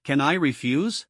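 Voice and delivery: ordinary delivery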